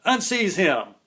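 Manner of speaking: high, loud reversal of the order; commanding shout. Retold or imitated. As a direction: call off the crew with authority. Gang leader reversing order.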